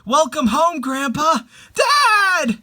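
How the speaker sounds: high, loud happy greeting; joyful welcome. Acted. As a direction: welcome them home with joy. Greeting the after images.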